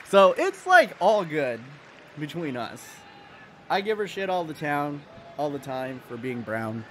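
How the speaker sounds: normal talking voice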